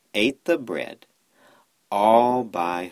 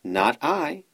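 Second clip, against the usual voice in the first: higher quacky reply; quacking duck; 7 semitones higher than usual. A narrator's reading. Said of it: "quacked the duck".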